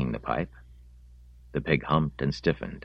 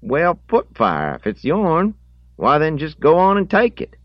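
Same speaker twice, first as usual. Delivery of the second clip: put-on country boy conceding indignantly; 10 dB louder than usual. Boy tells the owner to take his hog, high indignant voice. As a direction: pitch it way up as the caught boy. Voices Harrogate, caught with the pig.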